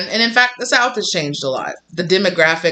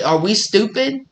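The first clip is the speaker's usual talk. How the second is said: usual speaking voice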